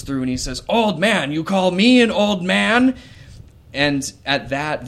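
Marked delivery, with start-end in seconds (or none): 0.7-1.3 put-on "Old man" thrown back in a loud, high, furious shout; enraged father repeating the insult, much louder and higher; raging patriarch. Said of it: "quintessential Sambridge patriarch rage". 1.3-3.0 loud, high, furious shout; offended father answering the old voice with a loud, high roar; raging patriarch. Acted. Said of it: "quintessential Sambridge patriarch rage".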